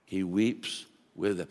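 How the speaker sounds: tender, very soft repeat; near-whispered echo. Shown as a demonstration. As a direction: let the repeat fall to a hush. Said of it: "repeated for emphasis".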